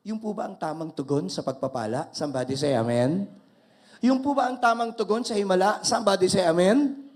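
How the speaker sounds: full-force preacher's call; preaching call-and-response. Real, not acted. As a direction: rouse the congregation to answer. Preacher calls for amen.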